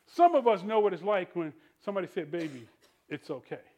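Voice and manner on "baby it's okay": soft, low, hushed reassurance; tender comfort. Retold or imitated. Soothing a hurting person, softly and low. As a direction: murmur it like soothing a hurt child.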